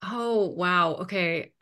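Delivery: ordinary talk, nothing marked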